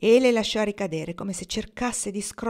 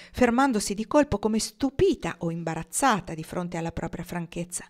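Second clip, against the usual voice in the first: ordinary delivery, nothing marked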